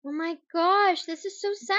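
high, drawn-out lament; real, stunned sorrow; dismayed. Real, not acted. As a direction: let the sad news sink in, pitched high. Grieving over death news just heard.

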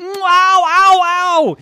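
high, loud rising "ow" cries; acted tumbling yelps. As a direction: build into yelps as you're flipped over. Jackson tumbled around in cube.

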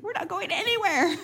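high, laughing disbelief; real giggle of disbelief. Real, not acted. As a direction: laugh out the line.

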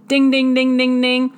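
loud dinging like a game-show bell; loud playful ding ding; mock bell. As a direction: ring it out like a winning buzzer.

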